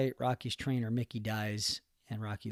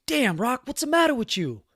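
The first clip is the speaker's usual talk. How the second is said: high, loud coach's yell; Apollo yelling; 12 semitones higher, 5 dB louder than usual. Retold or imitated. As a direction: yell at a slacking fighter from up the beach. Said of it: "he yells out".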